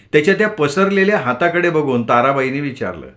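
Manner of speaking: usual speaking voice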